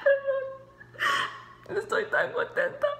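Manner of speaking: high, tearful voice breaking; crying with joy through the words; happy crying. Real, not acted. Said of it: "I'm crying".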